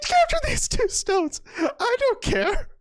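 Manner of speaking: very high, giddy imitation of a player; hammed-up squeaky defiance; mock defiance. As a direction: squeal the bravado, close to cracking up. Voices player's indifference, laughing.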